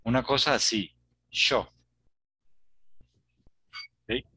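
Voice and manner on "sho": "yo" said as "sho"; hushed SH sound starting "yo", as in Argentina; teacher's demo of Uruguayan "sho"; Rioplatense pronunciation. Shown as a demonstration. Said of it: "en Argentina y en Uruguay".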